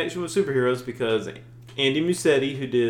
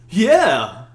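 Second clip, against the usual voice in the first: cheery app voice; 6 semitones higher, 10 dB louder than usual. Retold or imitated. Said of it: "And I was like".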